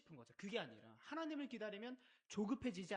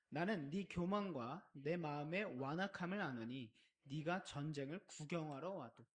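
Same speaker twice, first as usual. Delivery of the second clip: slow, loud communal Bible reading; loud scripture reading; 4 semitones lower, 5 dB louder than usual. Acted. Pastor leading the congregation in reading Eliab's words, slow and loud. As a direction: read the verse aloud together, slowly.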